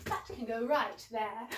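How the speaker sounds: usual speaking voice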